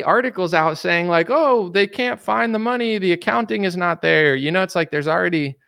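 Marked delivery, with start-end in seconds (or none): 1.3-4.4 high, sing-song mock innocence; sarcastic high voice aping officials; mocking quote. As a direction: mimic them playing dumb, voice up high. Mocking article saying money's missing.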